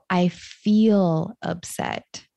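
"feel" slowed and leaned on; slow, weighted "I feel upset"; stress on feel. Shown as a demonstration. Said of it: "went from saying".

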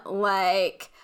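ordinary talk, nothing marked

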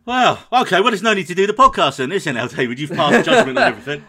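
loud, high, put-out protest; exasperated co-host in a mock huff; mock indignation. Real, not acted. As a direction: object loudly, half laughing, as if slighted. Mock huff at co-host's dismissals.

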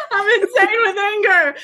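high, laughing mock rage; awkward attempt at cursing. Co-host echoing the priest's curse, high laughing mock anger. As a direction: echo a feeble angry outburst through giggles. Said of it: "he's, like, trying to curse".

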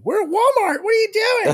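put-on high shocked girlfriend voice. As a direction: squeal it like an embarrassed girlfriend. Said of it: "and then she's like".

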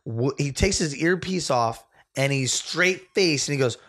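normal talking voice

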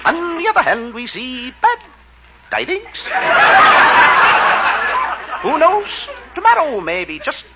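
slow newscaster delivery. Shown as a demonstration. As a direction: read it like a radio news bulletin. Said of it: "just one more".

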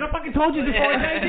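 high, loud, laughing protest; laughing exasperation. Retold or imitated. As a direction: blurt it through laughter. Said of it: "I said".

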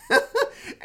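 high-pitched burst of laughter; real laugh. Real, not acted. Teller recapping absurd movie carnage, high laugh. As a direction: crack up high and briefly.

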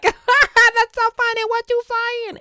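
high, loud cackling mock of friends; laughing friends. Host imitating friends cracking up, high-pitched laughing. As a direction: squeal it through laughter. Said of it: "all my friends was like".

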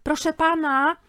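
high, loud call to a stranger. Acted. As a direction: call the old man across the railing. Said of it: "zawołała Iga".

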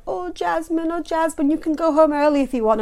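high, soothing mock sympathy; coddling coworkers. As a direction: coo it like fussing colleagues. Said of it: "every single person in that office, like".

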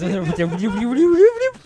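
rising, repeated wobbly mouth-made whoosh; silly vocal warp effect; warp noise. Pretend. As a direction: imitate a sci-fi warp with your voice. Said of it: "Warp noise".

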